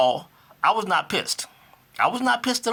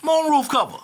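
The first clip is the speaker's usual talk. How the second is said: retold outraged cry, pitched higher; angry outburst; 9 semitones higher than usual. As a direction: replay finding the roof cover broken, indignant. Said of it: "I'm like".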